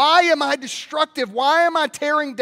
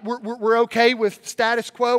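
normal talking voice

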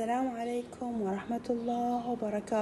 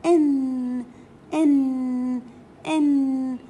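"إن" called out louder; loud teaching drill; 3 semitones higher, 8 dB louder, much slower than usual. Shown as a demonstration. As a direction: announce the sound loudly to the class. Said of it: "شنو صوت تنوين الكسر؟". (نطق "إن" بصوت عالٍ)

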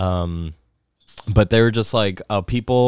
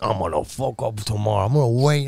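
imitating [name]; 4 semitones higher than usual. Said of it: "He's like".

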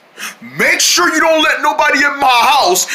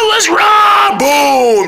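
high, loud angry yell; sudden furious outburst; angry shout; 9 semitones higher, 4 dB louder, much slower than usual. Acted. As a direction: blow up at them. Angry character erupts.

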